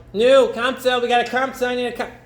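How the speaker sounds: high, loud calling through the town. Acted. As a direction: shout around for Kamsa. Servant searching town for Kamsa.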